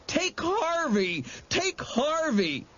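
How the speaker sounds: high, slow wailing cries; sobbing. A narrator's reading. Boy sobbing, urging the monster to take his friend. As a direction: wail it through sobs. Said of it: "he sobbed".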